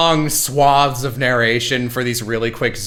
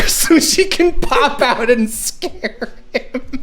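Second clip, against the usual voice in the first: high, slowed by real laughter; laughing while talking; 12 semitones higher, slower than usual. Real, not acted. Telling friends about the cabinet scare, breaking into high laughter. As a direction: crack up through the words. Cracking up at own story.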